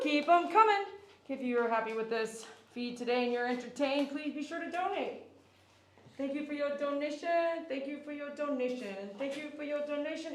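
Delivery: loud, bright stream-host thank-yous; donation shout-outs. Acted. Groomer thanking livestream donors, upbeat announcer read. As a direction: hype up every donor like an announcer.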